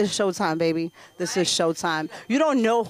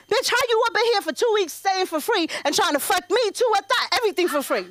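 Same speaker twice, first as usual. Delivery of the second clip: pitched up, scolding the husband; sassy rant; 9 semitones higher, faster than usual. Retold or imitated. Wife scolding husband for staying free, high sassy rant. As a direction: lay into him, sassy.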